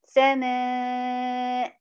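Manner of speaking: slow "سماء" as samaa-an, long held a; tanween as n. Shown as a demonstration. Teacher giving a new example, "سماء" drawn out with final n. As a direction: stretch "سماء" and close on -an. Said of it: "عليها تنوين بالفتح".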